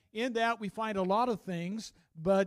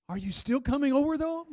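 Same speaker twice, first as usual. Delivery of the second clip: hopeful asking; 9 semitones higher, 8 dB louder than usual. Retold or imitated. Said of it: "the voice said".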